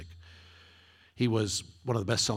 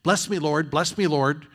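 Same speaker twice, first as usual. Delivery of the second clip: loud, high whiny "bless me, Lord"; mock the selfish cry for blessing; mocking prayer; 6 semitones higher, 8 dB louder than usual. Preacher mocks the congregation's selfish prayers, loud and whiny.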